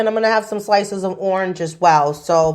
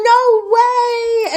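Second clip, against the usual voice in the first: high squeal of delight; excited squeal; 4 dB louder than usual. Retold or imitated. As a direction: squeal it like a thrilled friend. Her excited reply retold.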